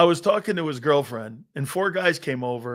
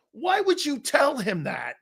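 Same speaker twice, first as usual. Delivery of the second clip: retold disbelief; 9 semitones higher than usual. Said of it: "I called my grandfather up and I said".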